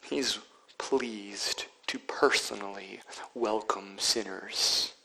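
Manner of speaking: tender whisper. Real, not acted. Tender hushed aside.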